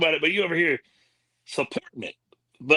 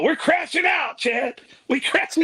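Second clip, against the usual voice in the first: hyped, higher call-out; fired up, rallying his partner; hyping the chat with his co-host, excited; excited shout; 9 semitones higher, slower than usual. Real, not acted.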